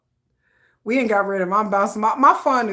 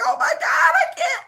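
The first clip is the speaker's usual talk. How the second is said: high laughing shout; overwhelmed fangirl. Pretend. Fan overwhelmed at spotting [name], laughing shout. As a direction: shriek it through laughter. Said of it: "and I'd be like".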